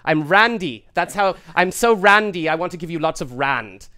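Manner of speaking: put-on loud excited South African accent; hammy rich businessman, very loud. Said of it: "This is his audition".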